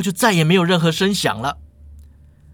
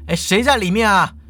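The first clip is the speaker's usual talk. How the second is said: high, raised call up to a window; higher-pitched storytelling yell; 6 semitones higher than usual. A narrator's reading. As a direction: call out to whoever is inside. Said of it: "就对着那扇窗口大叫了".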